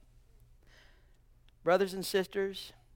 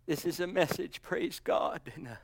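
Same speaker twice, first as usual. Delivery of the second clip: soft reassurance; 4 dB quieter than usual. Acted. Soft closing to the church.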